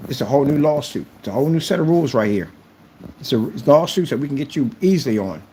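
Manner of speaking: usual speaking voice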